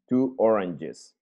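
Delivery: ordinary talk, nothing marked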